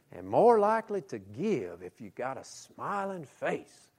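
slow Alabama drawl; a light Alabama salesman drawl; Alabama accent. Retold or imitated. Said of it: "falling into his Alabama accent".

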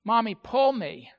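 put-on loud childish request to mommy; little kid pleading. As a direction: imitate a young child calling to mom. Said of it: "the younger of the two kids say".

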